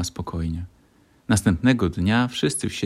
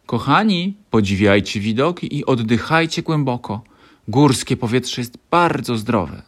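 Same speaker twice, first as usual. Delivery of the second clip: teacher voice; 4 semitones higher, 5 dB louder than usual. A narrator's reading. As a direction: call out to the group kindly. Teacher addresses the children.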